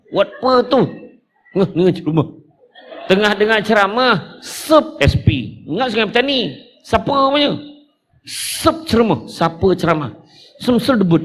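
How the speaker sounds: a touch of a chatty youngster; youngster texting. Retold or imitated. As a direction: mimic a slangy teen.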